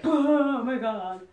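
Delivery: high, long drawn-out mock wail; hammed-up high groan of pain; hypothetical pain reaction. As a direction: wail it out slowly as if in agony. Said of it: "I'd probably be like".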